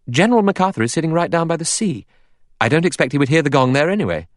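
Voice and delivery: high, quick, lightly voiced woman; young woman's voice. A narrator's reading. Vera explains where the General is, quick and high. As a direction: pitch it well up and talk briskly.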